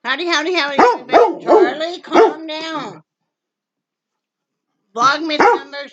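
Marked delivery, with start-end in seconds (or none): 0.0-1.4 loud, high, bouncy hello to the viewers; loud greeting. Real, not acted. 1.4-3.0 loud, high call to calm the dog; telling the dog off. Real, not acted. Scolding the excited dog.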